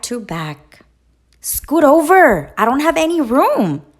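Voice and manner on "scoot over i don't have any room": high, loud, fast complaint; complaining. A narrator's reading. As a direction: grumble loudly at Goose to move. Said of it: "complained Duck".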